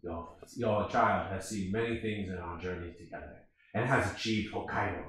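low, hushed, solemn warrior; Samurai Jack. Retold or imitated. Samurai to a mother as he walks away, low solemn hush. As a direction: drop deep and soft, like a grave samurai. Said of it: "And Samurai Jack's walking away".

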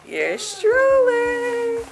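high, very slow drawn-out boast; hammed-up showoff. Daughter presents herself in a high hammed-up drawl. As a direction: stretch it out like a grand reveal. Showing off own nails.

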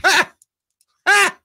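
short, loud high-pitched yelp; two sharp squeals, far above speech. Real, not acted. Host whoops after praising the win.

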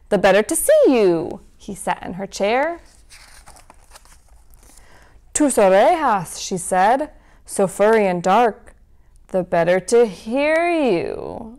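lively storybook read-aloud; reading to children. A narrator's reading. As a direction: read it like a bedtime story for kids. Reads picture book to children.

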